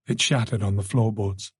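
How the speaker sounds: plain reading voice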